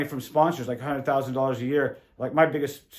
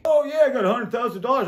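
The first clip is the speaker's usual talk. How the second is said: boastful; 8 semitones higher, 3 dB louder, faster than usual. Retold or imitated. Scotty bragging about sponsor money.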